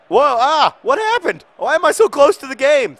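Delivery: high loud yelps of alarm; startled shouts. Real, not acted. Gamer startled by the camera zoom, high alarmed yells. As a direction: jump at the sudden close-up. Camera suddenly zooms in close.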